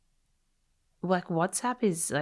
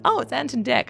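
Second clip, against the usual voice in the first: a high, bright retold oh of discovery; sudden recognition; 9 semitones higher, 4 dB louder than usual. Retelling the plane moment she recognized Ant and Dec, mock surprised. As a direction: act out spotting someone familiar with mild surprise.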